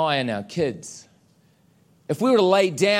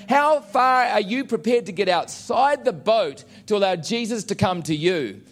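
normal talking voice

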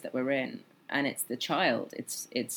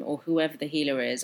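ordinary talk

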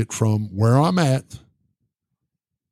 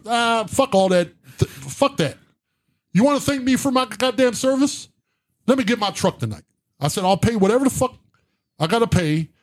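high-pitched, exasperated retold outburst; indignant demand; 9 semitones higher than usual. Veteran brushing off the cop's thanks to get his truck, indignant. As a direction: cut off the thanks with a worked-up demand.

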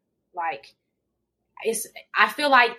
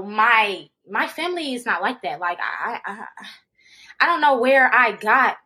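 usual speaking voice

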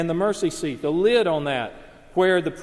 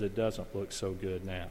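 quiet; 8 semitones lower, 10 dB quieter than usual. Retold or imitated. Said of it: "looks at the end and says".

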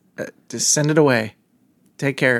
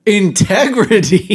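mocking; 5 semitones higher, 4 dB louder, slower than usual. Mocking cohost's word.

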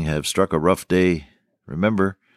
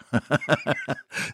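a short quiet chuckle; soft laugh; 5 semitones higher, 6 dB quieter than usual. Real, not acted. Recalling the doctor's advice to marry Corrine, soft chuckle. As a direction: laugh softly at the memory.

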